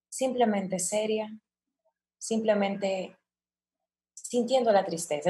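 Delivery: hushed, restrained sadness with long pauses; quiet, serious, contained sorrow; understated sadness. Shown as a demonstration. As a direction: say it very softly, just feeling the sadness. Illustrates restrained sadness, not weeping.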